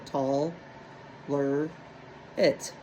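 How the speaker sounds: tall, ler, it said as separate beats; split into syllables. Shown as a demonstration. Teacher to class, chopping the word into syllables. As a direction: break the word into its syllables, pausing between them.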